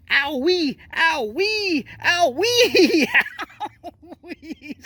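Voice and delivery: high-pitched, laughing imitation of a yelp; mock screaming. Host mocking the man in the clip who screams owie, mock screaming. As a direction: mimic his wailing, high and drawn out, breaking into laughter. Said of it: "he's really screaming".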